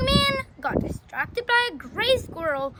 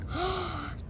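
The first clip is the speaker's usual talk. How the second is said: sharp surprised gasp; sudden intake of breath; 7 dB quieter than usual. Retold or imitated. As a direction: gasp in shock at the footage. Chief sees the video footage.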